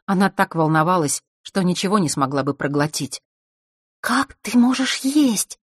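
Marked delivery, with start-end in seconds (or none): 4.0-5.6 high, slow, amazed question; sincere surprise. A narrator's reading. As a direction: ask in honest amazement. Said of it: "искренне удивилась девочка".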